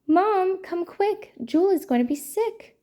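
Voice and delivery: high, alarmed storybook cry for help; urgent call. A narrator's reading. Nancy calling Mom as the puppy gets sick, urgent alarm. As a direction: call out urgently, pitch lifted throughout.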